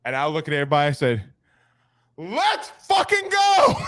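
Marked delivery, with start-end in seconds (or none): 2.2-3.8 high, stretched-out hype shout; drawn-out battle cry, breaking into laughter; rallying yell. Retold or imitated. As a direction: yell it to fire up the whole team. Retelling his rallying yell to team.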